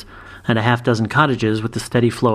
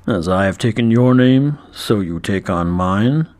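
basso dragon growl; 2 semitones lower, slower than usual. A narrator's reading. Dragon knight voiced in story.

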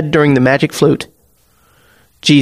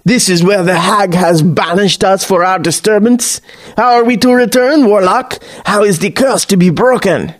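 higher, theatrical archaic villain voice; roaring interrogation; 4 semitones higher than usual. A narrator's reading. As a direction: demand answers like a cursed old seafarer. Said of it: "long enough to roar the question".

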